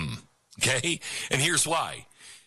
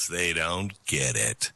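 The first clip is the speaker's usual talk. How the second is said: lower, slow dismissive scoff; dismissive mock; 10 semitones lower than usual. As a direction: shrug off the older crowd slowly. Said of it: "allows her generation to go".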